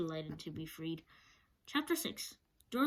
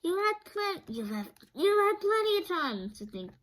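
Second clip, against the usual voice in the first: high, loud complaining voice; Tin Woodman voice; 11 semitones higher, 6 dB louder than usual. A narrator's reading. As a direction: protest that he had time enough. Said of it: "said the Tin Woodman".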